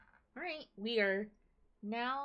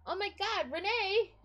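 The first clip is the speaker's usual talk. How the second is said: much higher, loud delighted shriek; excited squeal; 10 semitones higher, 10 dB louder than usual. Real, not acted. Spotting Renee in the game, a loud thrilled squeal. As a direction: call out her name in thrilled surprise.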